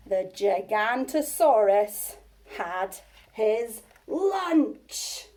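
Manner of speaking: very slow, deliberate climax; storyteller's dramatic slow finish; slow ominous ending. A narrator's reading. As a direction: draw out every word for suspense.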